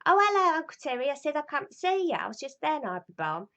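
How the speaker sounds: high, lightly voiced little girl; breezy greeting as her childhood self; chirpy child. Child calls in on Uncle Terry, lightly voiced chirpy child.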